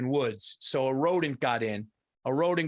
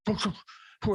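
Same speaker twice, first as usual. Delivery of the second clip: short muffled dog woofs; imitated dog bark; 4 semitones higher, faster than usual. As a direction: woof like an uneasy dog. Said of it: "he's going".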